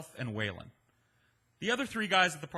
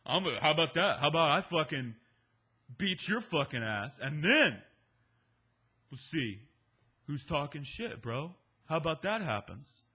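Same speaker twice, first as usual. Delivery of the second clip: louder, belligerent macho threat; drunk threat; 3 dB louder than usual. Retold or imitated. Drunk Waylon threatening to beat the narrator, loud macho threat. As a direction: square up and threaten him.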